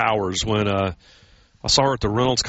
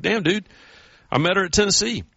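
higher, incredulous mock complaint; exasperated; 8 semitones higher than usual. Groom retelling his complaint to bandleader, higher and incredulous. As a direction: pitch it up in retold disbelief. Retold exasperation at band leader.

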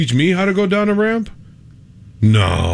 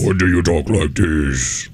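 very low, slow put-on voice; deep silly voice; 11 semitones lower, slower than usual. Shown as a demonstration. Co-host offering boy another voice, deep silly voice. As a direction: drop it way down and drag it out. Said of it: "What's your voice?".

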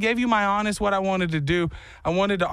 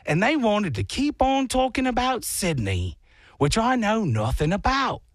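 fake Australian accent; 4 semitones higher than usual. Comic can't stop once started, hammed-up Australian accent. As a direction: narrate in a bogus Australian accent. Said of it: "you're slipping on your accent".

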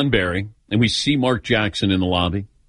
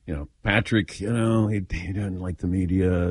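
soft, sighing aside, voice dropped low; uneasy friend explaining in a soft, sighing voice; 4 semitones lower, 5 dB quieter than usual. Retold or imitated. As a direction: mutter it apologetically after a sigh. Mark awkwardly explaining Patrick's stance.

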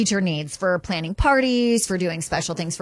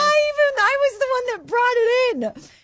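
very high, squealed disbelief; incredulous. Real, not acted. Room mom realizing she brought the gift in, incredulous. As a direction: voice shooting up in self-amazement. Incredulous at own memory lapse.

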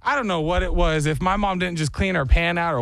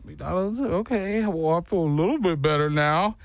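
mock sniffly kid giving in; consoled kid. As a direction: play a little kid calming down at a treat. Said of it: "I watched this kid immediately go".

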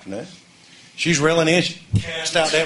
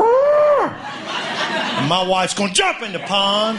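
ordinary talk, nothing marked

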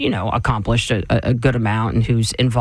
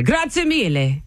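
Italian phrase; 10 semitones higher, slower than usual. Pretend. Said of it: "I'm gonna say".

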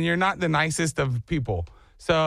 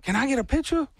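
higher, giddy fan asking for a photo; starstruck fan; 9 semitones higher than usual. Retold or imitated. Neighbor asking Adam Sandler for a picture, giddy. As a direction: gush like a starstruck kid.

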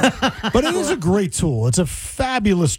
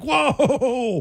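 high, laughing "whoa"; mock astonishment; 7 semitones higher than usual. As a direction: react in amused alarm, pitched well up. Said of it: "and I'm like".

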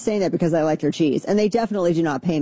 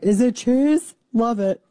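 mock delight; 5 semitones higher than usual. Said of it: "I'm like".

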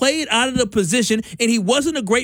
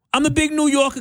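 higher-pitched, brash New York accent; 5 semitones higher than usual. Shown as a demonstration. Host showing off his commercial voice, New York accent. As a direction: put on a big New Yorker accent. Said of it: "I had a really good New York accent".

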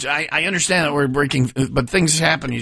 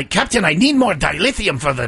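hammed-up Scottish engineer; Scottish accent; 3 semitones higher than usual. Shown as a demonstration. Co-host switching accents on request, Scottish accent. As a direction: put on a stagey Scottish burr. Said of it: "Now switch it to Scottish".

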